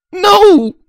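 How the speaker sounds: high, loud desperate cry. Acted. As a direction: shriek it in panic. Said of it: "she cried".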